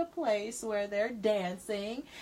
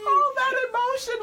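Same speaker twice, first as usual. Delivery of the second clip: high, loud mock wail; overdone weepy outburst; mock tearful; 9 dB louder than usual. Reacting to a Cancer horoscope, hammed-up tearful. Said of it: "as the tears coming out".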